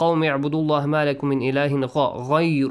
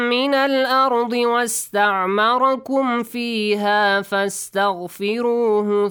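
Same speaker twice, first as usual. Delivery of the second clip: higher, louder chanted recitation; Quran recitation; 7 semitones higher, 4 dB louder than usual. Acted. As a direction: lift the voice into a chant. Leads recitation.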